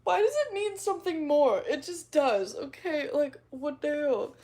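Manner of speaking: hammed-up defensive outburst; mock outburst. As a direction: exclaim it in mock exasperation. Acting out her flustered reaction.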